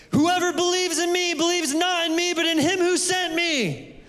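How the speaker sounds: high, ringing cry of proclamation. Shown as a demonstration. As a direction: cry it out to the crowd, high and full. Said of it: "I'm gonna cry out".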